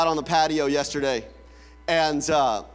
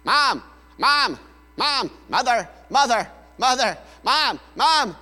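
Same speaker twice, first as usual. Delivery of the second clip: put-on nagging child; 2 semitones higher, 4 dB louder than usual. Child calling mother over and over, nagging and building. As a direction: pester Mom relentlessly, each call more urgent.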